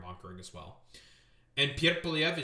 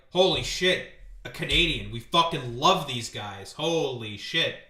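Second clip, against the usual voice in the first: loud, drawn-out hammed-up awe; mock starstruck delight, emphatic; imitated amazement; 8 dB louder than usual. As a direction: gush loudly, stretching out the words. Imagined Dutch delight at meeting Canadian.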